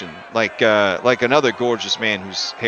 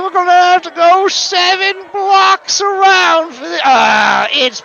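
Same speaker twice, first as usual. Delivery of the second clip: high, loud exasperated grumble; fed up, blaming Reese; annoyed NPC; 8 dB louder than usual. Acted. NPC complains to the party about the protest, high and loud. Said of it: "he says".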